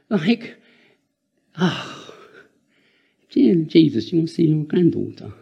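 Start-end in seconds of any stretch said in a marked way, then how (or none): none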